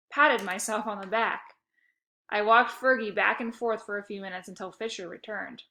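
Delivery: usual speaking voice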